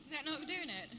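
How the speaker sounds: a put-on British accent, fairly light. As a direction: try out a British accent on the spot. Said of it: "do a British accent".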